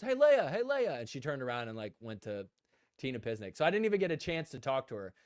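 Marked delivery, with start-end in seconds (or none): 0.0-1.0 higher, louder call across the room. Retold or imitated. Tina calling out to Leia across the crowd, loud and bright. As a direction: call out to get her attention. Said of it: "Tina Pisnik actually goes".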